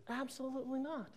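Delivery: high, very soft little voice; mock-coy denial. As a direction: deny it in a tiny high-pitched voice. Answers own question, then repeats straight.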